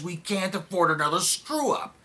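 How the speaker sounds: high, loud, scornful echo; angry mocking quote. Bitterly quoting the president behind his desk, indignant. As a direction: throw the warning back with indignation. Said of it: "he sits there with those flags".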